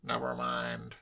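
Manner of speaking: soft, grumbled rebuff; curt uncle. A narrator's reading. Uncle dodging his nephew's question, curt. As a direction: close the subject, keeping it soft.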